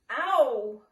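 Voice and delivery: loud, drawn yelp; cry of pain. A narrator's reading. Pooh to Rabbit who is pulling him, pained cry. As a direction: yelp as if your paw is pulled. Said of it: "he cried".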